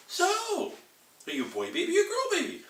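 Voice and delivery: put-on very high little girl voice; baby girl. Baby girl quizzing the new boy, very high voice. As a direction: ask it like a curious toddler. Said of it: "Little baby girl's like".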